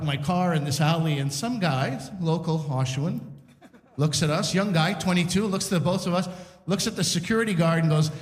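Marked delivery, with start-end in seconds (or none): none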